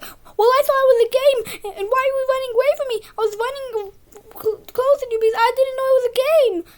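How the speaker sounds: put-on high, loud, fast whining kid; mock whiny excuse. Boy mimics Jack excusing himself, high and whiny. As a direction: rattle off a bratty excuse.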